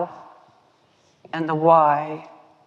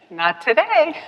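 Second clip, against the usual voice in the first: mock refusal; 10 semitones higher than usual. Inner refusal.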